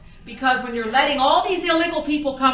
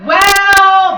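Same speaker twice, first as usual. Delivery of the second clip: loud scoff; 7 semitones higher, 12 dB louder than usual. Real, not acted. Sarcastic setup in rant.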